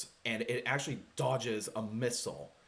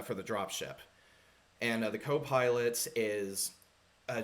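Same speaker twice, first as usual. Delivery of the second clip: ordinary talk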